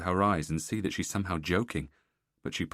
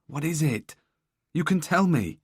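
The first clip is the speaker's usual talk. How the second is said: urgent pleading; 9 semitones higher, 7 dB louder than usual. Acted. Husband begs wife to talk.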